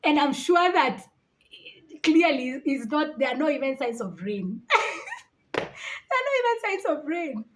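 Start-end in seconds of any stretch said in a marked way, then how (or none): 4.7-5.2 high burst of laughter. Real, not acted. Laughing at no rain.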